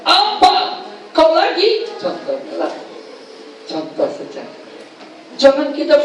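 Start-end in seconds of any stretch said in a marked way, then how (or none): 0.0-1.9 much higher, irritated "Apa? Kau lagi?"; annoyed retort. Retold or imitated. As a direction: snap back as if fed up. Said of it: "Kau ajak dia". (jawaban kesal dengan nada tinggi)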